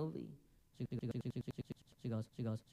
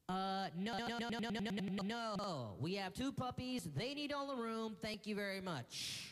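snooty lady; 8 semitones higher than usual. Retold or imitated. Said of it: "the lady with the puppies is like".

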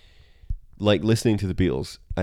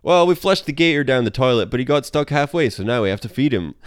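Bart voice; 5 semitones higher, 5 dB louder than usual. Retold or imitated. Bart voiced telling Marge.

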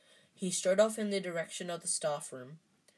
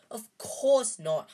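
brisk; 5 semitones higher, 7 dB louder, slower than usual. A narrator's reading. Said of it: "said Hermione briskly".

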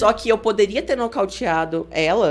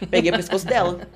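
normal talking voice

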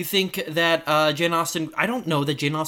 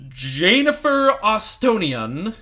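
grand announcer voice; 6 semitones higher, 5 dB louder, much slower than usual. Pretend. Grand mock name.

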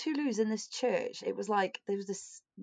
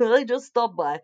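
bad Russian accent; 3 semitones higher, 11 dB louder, slower than usual. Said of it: "That was really bad".

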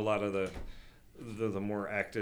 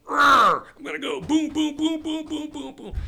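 high, loud gung-ho grunt voice; hyped-up marine; 11 dB louder than usual. Retold or imitated. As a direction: charge ahead all pumped up. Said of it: "All right, there's a pack on".

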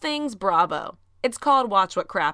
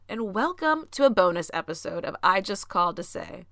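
ordinary talk, nothing marked

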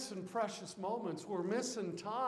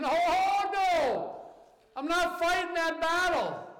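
mock fearful soldiers; 9 semitones higher, 7 dB louder than usual. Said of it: "the army of Israel and saying".

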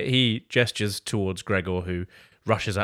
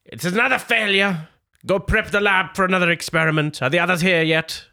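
high, loud mad scientist call; mad doctor shouting; 8 semitones higher, 4 dB louder than usual. Acted. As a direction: bellow for the servant, high and loud.

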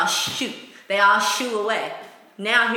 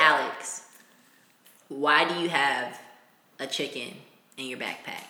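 straight-faced teacher; 9 semitones lower, 6 dB quieter than usual. Retold or imitated. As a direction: ask it dead serious. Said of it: "ask with a straight face".

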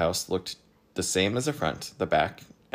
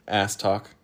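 usual speaking voice

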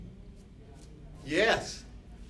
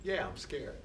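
late-night inner voice; 9 dB quieter than usual. Acted. Inner voice late at night confessing fear, quiet admission. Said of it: "A voice really late at night says".

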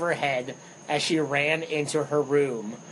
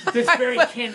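laughing; 9 semitones higher, 4 dB louder than usual. Real, not acted. Laughing at own Marine joke.

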